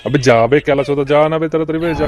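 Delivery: loud rough bark to get him moving; angry yell. Acted. Boss yelling at his lackey to hurry off, loud. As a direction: shout him out the door, fast.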